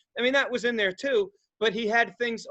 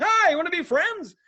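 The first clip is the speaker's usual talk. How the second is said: much higher, louder eager friendly voice; naive stoner; 10 semitones higher, 4 dB louder than usual. Retold or imitated. Stoner offering weed to strangers, eager and much higher. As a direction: chirp it like a clueless friendly stoner. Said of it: "I just carry my pot around like".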